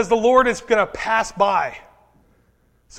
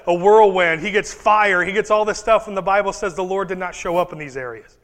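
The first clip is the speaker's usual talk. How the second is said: ordinary talk, nothing marked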